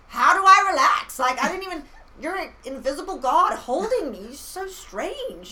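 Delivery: loud, high-pitched mock indignation; hammed-up bewildered complaint, high and loud; exasperated outburst. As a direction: protest at full volume, voice shooting up. Acted exasperated argument with God.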